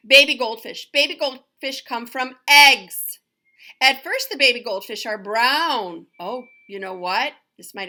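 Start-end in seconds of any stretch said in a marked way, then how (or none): 0.1-6.1 loud, bright read-aloud voice; reading to children. Acted. Teacher reading the baby goldfish chapter aloud to her class, bright and loud. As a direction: announce the new chapter to the class.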